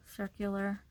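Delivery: ordinary talk, nothing marked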